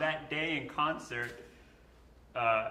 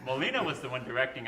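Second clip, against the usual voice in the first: ordinary delivery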